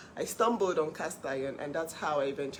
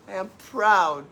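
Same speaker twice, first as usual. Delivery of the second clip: "I am proud" said louder, firm stress on "proud"; emphatic; 8 dB louder, slower than usual. Shown as a demonstration. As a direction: declare "I am proud", leaning hard on "proud".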